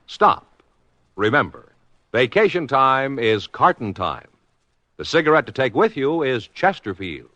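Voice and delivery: ordinary talk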